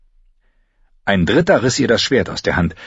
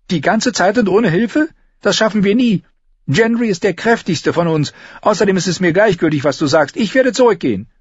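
high, heated character voice; high-pitched, impatient determination; defiant, angry Arya; 12 semitones higher, 3 dB louder than usual. A narrator's reading. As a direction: argue stubbornly in a high voice.

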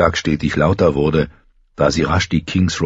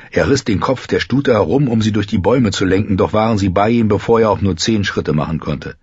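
ordinary delivery, nothing marked

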